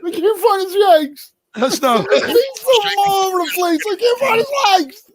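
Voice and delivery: high, hammed-up fake sobbing; mock crying. Friend mocking Sean's dad pain with hammed-up fake crying. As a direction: wail it like a pretend heartbroken friend. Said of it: "he's dropping tears in his beer".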